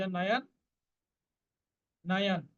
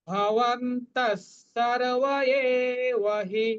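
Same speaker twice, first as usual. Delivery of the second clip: slow Sanskrit recitation; 3 semitones higher, 4 dB louder, much slower than usual. Shown as a demonstration. Teacher chanting a Gita verse slowly for students. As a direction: chant each syllable slowly. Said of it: "বিসর্গের পরিবর্তে আমরা দন্ত স্বর উচ্চারণ করব অর্ধেক".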